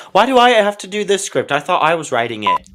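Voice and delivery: loud, high-pitched complaint; exasperated outburst. Acted. Host breaking off to complain about his script, exasperated. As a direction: burst out in protest at the script.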